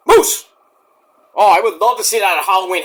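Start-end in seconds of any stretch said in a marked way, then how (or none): 0.1-0.5 Moose squealed high and loud; excited shout. Real, not acted. Fan calling for his favorite wrestler, shouting Moose high. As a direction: yelp the name Moose in delight. Says name twice, second louder.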